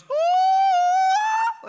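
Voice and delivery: very high, loud sustained "ooh"; imitated blaring music. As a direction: belt out a soaring opera note. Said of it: "like, loud".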